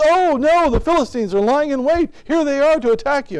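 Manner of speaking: high, loud fake panic; mock alarm. Delilah fakes alarm to Samson, high mock panic. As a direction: cry out a staged warning. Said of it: "And then she says".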